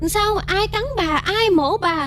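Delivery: high, anxious voice of a daughter; acted worried questioning. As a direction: fret over a suffering mother. Daughter questions her dying, screaming mother.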